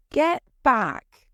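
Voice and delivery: threatening order, a knife in hand; angry. Acted. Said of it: "he said angrily".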